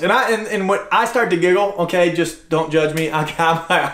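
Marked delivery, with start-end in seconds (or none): none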